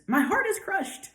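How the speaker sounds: high-pitched theatrical heartbreak; hammed-up cry of a crushed lover; mock anguish. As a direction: wail it in mock despair. Acting Manfred's anguish as figure vanishes.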